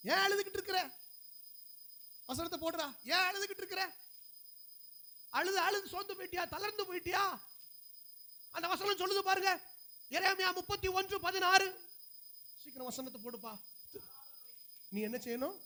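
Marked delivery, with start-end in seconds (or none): 0.1-11.7 high-pitched, urgent pulpit questioning; preacher challenging the flock; exhorting preacher. Real, not acted. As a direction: press the congregation in a raised, high voice. Exhorts congregation in raised voice.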